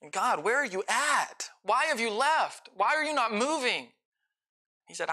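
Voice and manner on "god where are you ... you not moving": higher and rising in urgency, crying out to God; anguished prayer. Acted. A believer crying out when the emotion will not come, higher and urgent. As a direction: call out to God, desperate and hurt.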